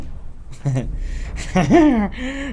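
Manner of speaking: high, drawn-out nervous laughing fit; nervous laughter. Real, not acted. Player scared by the knocking, nervous laughter. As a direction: laugh helplessly out of fright.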